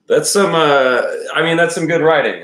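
raving about Stephen King's writing; excited praise. Real, not acted. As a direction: gush about how good the prose is.

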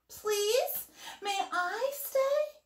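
high, drawn-out plea; pleading little owl. A narrator's reading. Little Owl asks the bats to stay, high and pleading.

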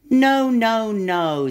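upset little monkey protest. Acted. Monkey protests wrong animal.

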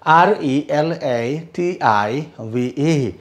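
ordinary talk, nothing marked

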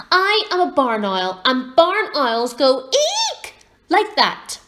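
loud, indignant, with a high screech; very cross. A narrator's reading. Cross owlet demonstrates his cry to the girl, loud and indignant. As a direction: insist loudly and screech out the eek. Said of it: "getting very cross".

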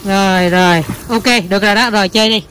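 ordinary delivery

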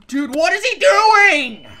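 loud, high, stretched yell; horrified yelling at the wrecker; outraged shout. Real, not acted. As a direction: scream in disbelief. Yelling at NPC.

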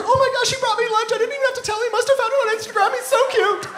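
woman gushing to Megan, high and singsong; gushing girl. Retold or imitated. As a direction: squeal it like a smitten girl. Woman gushing about lunch.

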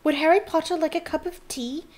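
high squeaky elf voice; Dobby. A narrator's reading. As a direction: squeak it eagerly like a house elf. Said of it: "he squeaked loudly".

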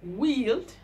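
wield with a long ee vowel; "Wield" said as weeld, held ee. Shown as a demonstration. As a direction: stretch the ee in "Wield". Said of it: "S W I E L D".

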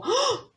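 high, sharp gasp; startled gasp. Acted. Hunter overhearing cries for help, startled gasp. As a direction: gasp as if suddenly hearing something.

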